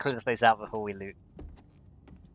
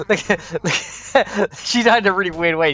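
high, loud laughing commentary; 7 semitones higher, 10 dB louder, slower than usual. Real, not acted. Gamer pointing out a weird death, laughing. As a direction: talk through real laughter, voice pitched up.

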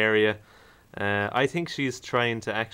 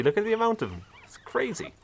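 excited; 12 semitones higher than usual. Real, not acted. Marvelling at the huge puppies.

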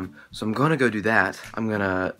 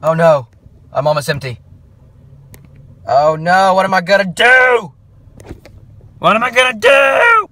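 much louder, higher hammed-up alarm; mock panic; 7 semitones higher, 10 dB louder than usual. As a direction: ham up the panic over the gas. Car almost empty, mock panic.

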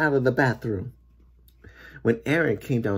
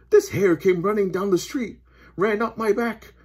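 higher-pitched complaint; distressed policeman; 10 semitones higher, 4 dB louder than usual. A narrator's reading. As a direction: pour out the trouble as a flustered officer.

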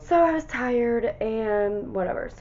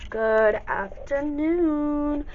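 sing-song greeting; 8 semitones higher, 5 dB louder, much slower than usual. Real, not acted. Vlog greeting, drawn out.

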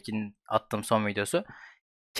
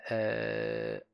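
ordinary talk, nothing marked

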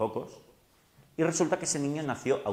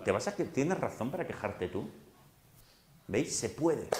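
ordinary talk

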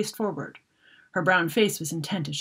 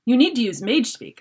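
louder, raised urgent call; shouting; 5 semitones higher, 5 dB louder than usual. Acted. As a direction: shout over the chaos. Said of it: "I shouted".

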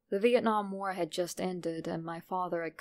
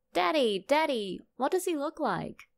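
put-on higher, eager little boy's voice; young son; 7 semitones higher, 3 dB louder than usual. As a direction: play the curious little son. Father voices young son asking.

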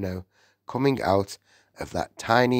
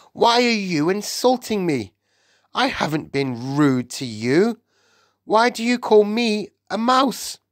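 put-on high, indignant boy's voice; offended protest; 12 semitones higher, 3 dB louder than usual. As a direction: protest as if deeply insulted. Bruno protests at being insulted.

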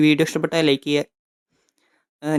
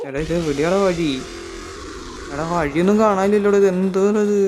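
higher, louder frantic calling; panic, shout to your friend for a way out; panicked; 4 semitones higher, 4 dB louder than usual. Real, not acted. Gamer chased by monster yelling to friend, frantic.

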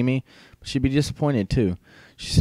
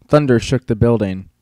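ordinary delivery

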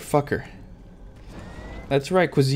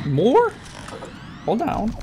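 surprised; 9 semitones higher, slower than usual. Real, not acted. Surprised by more enemies.

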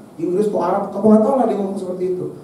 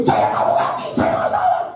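high shrill mock shriek of a furious lady; imitating angry woman. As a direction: squeal it like the outraged woman who hit your car.

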